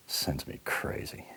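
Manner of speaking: slow whisper, drained; hushed disgust at himself; bitter whisper. Acted. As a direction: barely breathe it. Bitter murmured aside.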